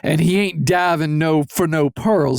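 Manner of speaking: a hint of a genteel Southern gent telling a joke; Southern gentleman accent. Southern gent teasing the hosts, in a light Southern accent. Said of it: "with the fucking Southern gentleman accent".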